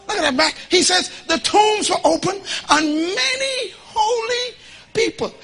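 loud, high, slow preaching cry; preaching at full force. Acted. Preacher rousing the church, high and loud. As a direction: build the congregation up, shouting.

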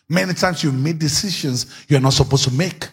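ordinary delivery

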